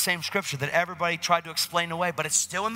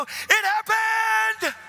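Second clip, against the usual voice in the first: high, loud, drawn-out squeal; excited shout; 5 dB louder, much slower than usual. Retold or imitated. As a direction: scream the news, stretching it out. Excited news to Dan.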